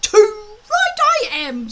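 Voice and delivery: high, slow, grumpy dragon voice; grumpily. A narrator's reading. Small dragon grumbling a reply to Hiccup. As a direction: huff it out like a sulking dragon. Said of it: "said Toothless grumpily".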